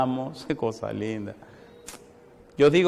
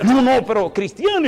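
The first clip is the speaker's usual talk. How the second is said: alarmed protest; 9 semitones higher, 6 dB louder than usual. Retold or imitated. Host panics as pastor kneels.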